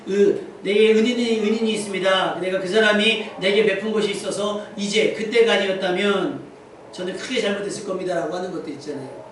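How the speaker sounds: ordinary talk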